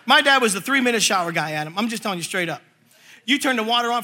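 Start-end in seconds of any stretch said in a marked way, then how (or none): none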